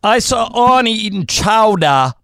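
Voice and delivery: drawn-out Boston accent try. Shown as a demonstration. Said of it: "better than I'll do".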